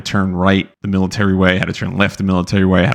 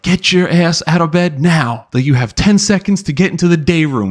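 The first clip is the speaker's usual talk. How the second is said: drill instructor yell; 8 semitones higher than usual. Retold or imitated. Drill instructor yelling at new recruits to get out of bed. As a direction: bark orders like a drill instructor waking recruits.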